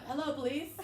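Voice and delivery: high, loud pretend phone call, half laughing; faked police call. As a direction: badly fake a call to the police. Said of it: "I'm, like, faking it".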